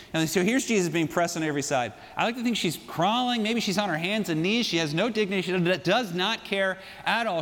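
ordinary delivery

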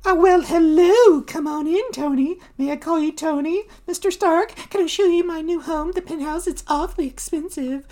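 very high, fawning hostess; Debbie the socialite. Acted. Hostess greeting Tony Stark at her party, high fawning voice. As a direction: flirt in a high society-lady voice. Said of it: "Debbie's like".

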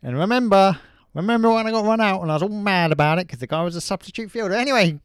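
loud, high Australian cricketer voice; Ricky Ponting. Pretend. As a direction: rattle it off as a loud Aussie sportsman. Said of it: "Ricky Ponting here".

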